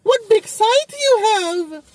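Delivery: high, slow, feeble voice of a dying patient; mock frail little voice looking up at death. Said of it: "looking up at the Grim Reaper saying".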